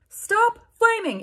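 put-on high, slow whiny teen writer; mock author voice. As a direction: whine it like a touchy fanfic author.

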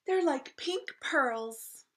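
high, slow, loud adoration; gushing queen. A narrator's reading. Queen gushing over her baby's feet, delighted exclaiming.

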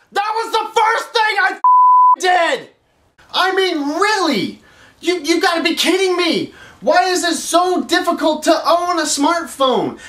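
high, frustrated outburst; exasperated rant. Acted. Caller fed up with tech support, high exasperated outburst. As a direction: vent like you've had enough.

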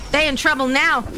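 ordinary talk, nothing marked

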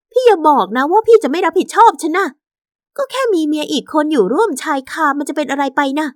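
high-pitched, insistent other woman; wheedling mistress. Acted. Mistress demands the husband take responsibility, wheedling. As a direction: press him in a high, coaxing voice.

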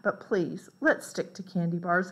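ordinary delivery, nothing marked

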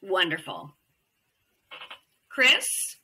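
ordinary talk

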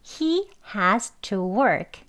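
slow, careful He has to work; slow model sentence. Shown as a demonstration. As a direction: read it slowly as a model. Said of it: "여기서 has to는요".

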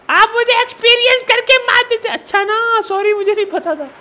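high squeaky pet-like voice, pitched well up; Pappu voice. Pretend. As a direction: play the little creature whining. Voicing Pappu the game character. (Pappu की ऊँची पतली आवाज़)

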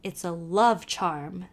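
slow, secretive Irish hint; a sly hushed confidence; mysterious. Acted. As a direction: let it out like a secret charm. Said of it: "said old Kelly mysteriously".

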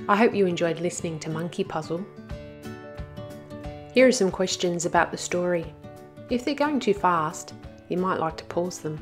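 ordinary delivery, nothing marked

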